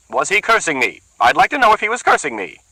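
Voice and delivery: high, loud accusing woman; suspicious woman. Acted. Bertha accuses the muttering man, high and loud. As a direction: bristle with suspicion.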